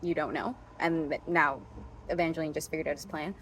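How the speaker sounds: ordinary delivery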